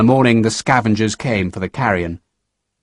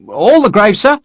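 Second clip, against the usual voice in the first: higher, louder questioning voice; lieutenant asking; 9 semitones higher, 5 dB louder than usual. A narrator's reading. Lieutenant questions Sharp's order, raised. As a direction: check the order with surprise.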